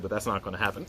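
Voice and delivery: usual speaking voice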